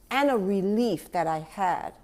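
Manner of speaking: ordinary delivery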